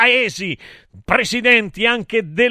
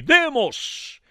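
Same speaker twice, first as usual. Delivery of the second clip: mildly raised proclamation; declaimed, higher-pitched word; crying out; 7 semitones higher than usual. A narrator's reading. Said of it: "e gridò nel buio dell'Olimpo".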